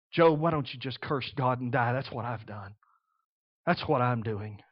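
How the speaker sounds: low, soft, hopeless voice; despairing wife. Retold or imitated. Wife urging Job to curse God, despairing and low. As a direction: sink into despair.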